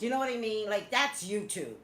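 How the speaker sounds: ordinary talk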